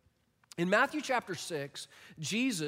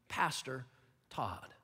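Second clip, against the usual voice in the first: echoed softly; 6 semitones lower, 7 dB quieter, slower than usual. Shown as a demonstration. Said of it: "it gives context".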